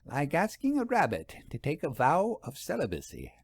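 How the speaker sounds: lightly voiced older lady savoring the phrase; a hint of amused relish, tapping the bottle; Vera repeating with relish. A narrator's reading. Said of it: "while repeating".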